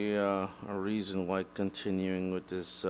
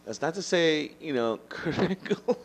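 talking through laughter, higher; laughing; 8 semitones higher than usual. Real, not acted. Backpedalling on his Kurt Angle joke, laughing. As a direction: laugh while trying to take it back.

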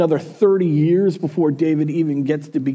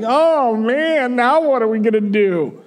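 mock moping; 7 semitones higher than usual. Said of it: "just moping".

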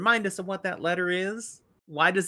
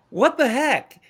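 higher and louder, stunned friends; mock shock; 9 semitones higher, 7 dB louder than usual. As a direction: gasp it at Nynaeve. Friends staring at Nynaeve.

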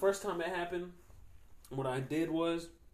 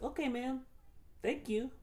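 high-pitched, exaggerated politeness; overly nice; 11 semitones higher than usual. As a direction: lay on mock sweetness in a high voice. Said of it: "be really nice to her. Like, overly nice".

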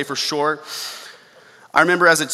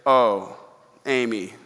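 tender sigh; 5 semitones lower, slower than usual. Pretend. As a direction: breathe her name with fond relief. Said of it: "feeling lonely or sad".

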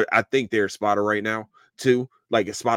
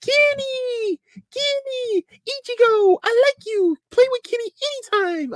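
very high, squeaky falsetto; squealed playful character voice; Yacharu impression. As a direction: imitate a tiny, excitable cartoon kid calling out. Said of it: "We haven't seen where Yacharu is".

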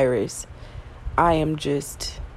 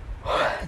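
short unvoiced yawn; quick tired yawn; 6 dB quieter than usual. Real, not acted. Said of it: "The yawns are serious".

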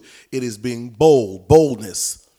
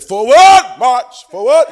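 high, loud rallying cry; imitating a church mother; 9 semitones higher, 12 dB louder than usual. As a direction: call out a marching order like Mama Morgan. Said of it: "Then Mama Morgan got up and said".

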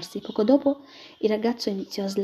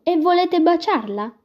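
much higher, much louder, slow; loud probing question; 9 semitones higher, 8 dB louder, slower than usual. Acted. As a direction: press him with a loud question. Clopin questioning the captain.

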